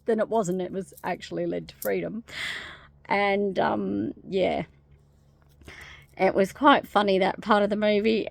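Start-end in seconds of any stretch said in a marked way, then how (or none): none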